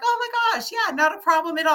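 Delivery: put-on squealed reply to a grateful old man; delighted. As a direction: brush off thanks with joy. Answering the man's thanks.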